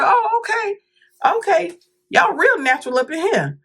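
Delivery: high, squeaky sarcastic "oh, okay"; mock surprise. To the nurse with the homemade washcloth, high mock surprise. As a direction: pretend to be impressed, dripping irony.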